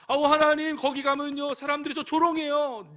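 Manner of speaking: escalating whine of a believer to God; whining plea. Acted. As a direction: protest desperately to God. Believer pleading louder.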